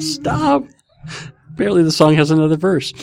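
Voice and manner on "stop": high squeaky stop; laughing stop. Real, not acted. Host begging his wife to stop, high laughing. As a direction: plead through a giggle.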